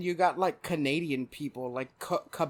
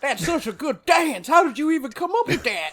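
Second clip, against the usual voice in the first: much higher and louder, gushing fake praise; mock amazement; 12 semitones higher, 8 dB louder than usual. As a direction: gush like a starstruck fan, overdoing it. Joining the mock onlooker bit.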